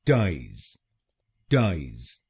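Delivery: टाइज said "tize", rhyming with "size"; American syllable demo. Shown as a demonstration. Teacher sounding out the American third syllable. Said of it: "हम बोलेंगे".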